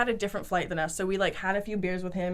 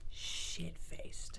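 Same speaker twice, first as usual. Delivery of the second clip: hushed confession; 4 semitones lower, 15 dB quieter than usual. Real, not acted. Confessing how drunk they were.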